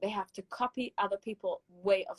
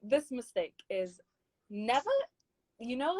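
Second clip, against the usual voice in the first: ordinary talk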